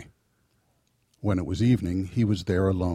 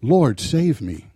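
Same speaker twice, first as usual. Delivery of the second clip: slow, raised plea, read aloud; measured scripture-reader's cry for rescue; 5 semitones higher, 5 dB louder, slower than usual. A narrator's reading. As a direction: call out louder and higher, drawing it out. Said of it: "he cried out".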